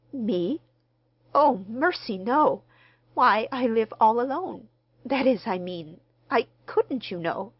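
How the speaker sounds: flustered, loud, breaking into fragments; flustered little dressmaker, groping for words; confused stammer. A narrator's reading. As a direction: stumble over a startled refusal. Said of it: "she stammered confusedly".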